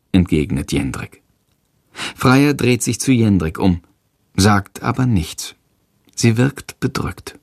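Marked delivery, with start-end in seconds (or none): none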